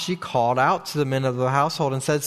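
ordinary delivery

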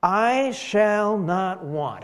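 slow, emphatic declaration; measured outburst of praise; proclaimed outburst. Acted. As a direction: proclaim it slowly, drawing out each key word. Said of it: "he starts with an outburst".

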